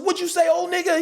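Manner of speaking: high-pitched, mouthy comeback; cocky challenge. Retold or imitated. Young actor squaring up to an older one, high and cocky. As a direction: fire it back high and provoking.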